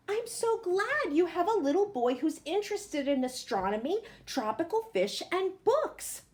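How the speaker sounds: gushing a little as the friendly neighbour; lightly voiced neighbour. Neighbour greeting mother on moving day, lightly sweet.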